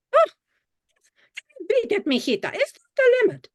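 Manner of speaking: high, mock-indignant Spanish-accented grandma; mock offended grandma. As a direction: playfully shoo them off in grandma's Spanish accent. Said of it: "she pretended to be very offended".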